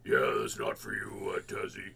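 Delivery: a hint of a deep, drawn-out neighbor; impression of the neighbor. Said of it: "I'm really bang on impression of her".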